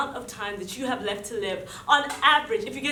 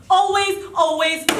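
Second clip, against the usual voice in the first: loud, higher insistent always; emphatic always; 5 semitones higher, 7 dB louder than usual. Acted. Soldier stressing the rule to the listener, loud insistent. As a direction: hammer home "Always" loud and high.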